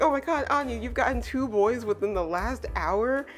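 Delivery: high-pitched excited gush; imitated freaking out. Becky marvelling at Anya's two boys, mock freaking out. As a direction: act out a friend's giddy freak-out. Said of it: "freaking out".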